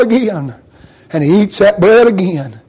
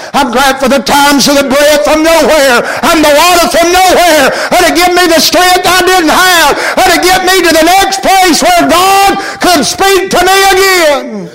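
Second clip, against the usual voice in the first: full-force preaching; 9 semitones higher than usual. Acted. Preacher crying out to the congregation about God's strength, full-force preaching. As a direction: lift the congregation with a ringing higher shout.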